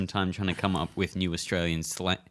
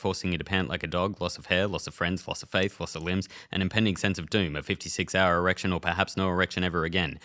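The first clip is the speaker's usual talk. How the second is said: quieter, rattled-off side effects list; mock disclaimer; 3 semitones lower, 3 dB quieter than usual. Ad voiceover reading the side effects, mock disclaimer. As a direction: rush through the small print like an ad disclaimer.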